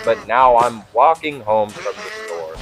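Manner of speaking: ordinary delivery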